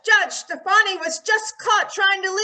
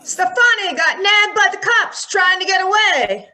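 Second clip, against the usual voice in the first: reporter calling out; 10 semitones higher than usual. Acted. Reporter breaks the arrest news at the door, calling out. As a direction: throw the news out over the crowd.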